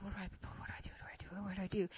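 whispered, repeated fretting; hushed mock panic, very soft; muttered panic. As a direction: mutter frantically to yourself. His private panic in cab.

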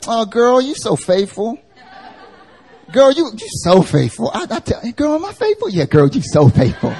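put-on high, laughing girlfriend voice; gushing girlfriend. Flattering friend gushes over her faithfulness, high and laughing. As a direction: gush like a flattering best friend.

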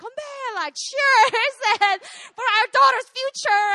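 high, loud, jubilant cheer; imitated celebratory toast. Weeping parents suddenly toast daughter's future, imitated jubilant cheer. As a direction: shout it like raising glasses at a party. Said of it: "it became a party".

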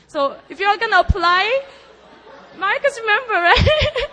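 high, loud, laughing and crying; laughing through tears. Real, not acted. Teasing Pastor Marcus before the church, laughing and crying. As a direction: laugh and weep as you tease. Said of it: "laugh cry".

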